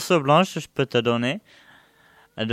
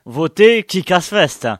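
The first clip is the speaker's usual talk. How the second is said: slogan shout; 7 semitones higher, 7 dB louder, slower than usual. Acted. Campaign slogan for the festival.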